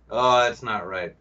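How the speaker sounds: usual speaking voice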